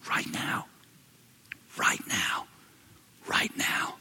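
hushed whisper, repeated; whispered awe to the congregation. Real, not acted. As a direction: breathe it out in a whisper. Pastor hushing a solemn truth.

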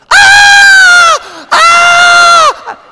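long, very high, loud screech; toddler's scream. Retold or imitated. Preacher mimicking his daughter screaming in the car seat, piercing scream. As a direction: imitate a child's piercing sustained scream. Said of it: "She would scream".

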